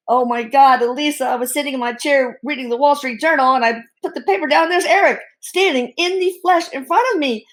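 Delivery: mock frantic, climbing in pitch; retold panic. Father calls his daughter in a panic, a mild imitated frantic voice. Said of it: "when he called in an absolute panic".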